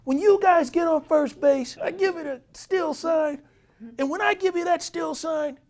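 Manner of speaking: high, loud exasperated manager; high, angry manager voice. Retold or imitated. Manager berating his players over the book, high and loud. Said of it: "He says".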